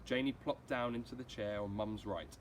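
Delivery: plain reading voice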